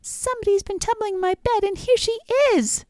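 very high, squeaky cub voice; little bear discovering the girl in his bed, piping high. A narrator's reading. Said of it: "the little bear piped".

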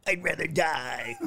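slow, high stubborn refusal; voicing Scott. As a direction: speak for a friend who won't give in. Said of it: "That's what he's saying right now".

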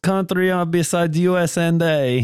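put-on Russian accent. Co-host boasting of the greatest country, mock Russian accent. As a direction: ham up a proud Russian accent. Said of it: "in a Russian accent".

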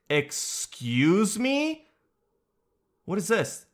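high, slow incredulous excuse me; surprised. Real, not acted. Reading UFO news, host is high and stunned. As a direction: act stunned by the headline.

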